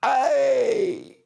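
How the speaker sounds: very high hammed-up yelp; over-the-top movie death yelp; mock death cry. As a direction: shriek like a cowboy-movie extra shot backwards.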